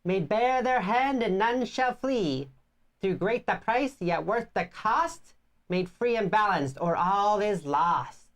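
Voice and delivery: slow, solemn elder woman; old lady voice. Acted. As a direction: intone it like an ancient village elder. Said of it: "I like your voice that you're using for the, like, old lady voice".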